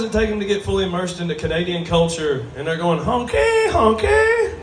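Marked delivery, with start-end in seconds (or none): 3.0-4.5 very high, squawking honky like a goose; goose honk. Retold or imitated. Comic honking at the crowd, very high goose squawk. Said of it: "to yell honky at you".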